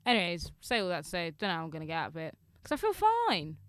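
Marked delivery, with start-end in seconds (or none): 2.6-3.6 high, wry fall on fine; ironic fine. Acted. Ending her worries with a high ironic fine. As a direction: land the irony. Ironic punchline about depression.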